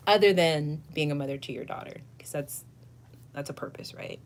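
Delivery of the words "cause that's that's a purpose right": soft whispered aside; a quiet whispered aside. Real, not acted. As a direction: drop to a whisper.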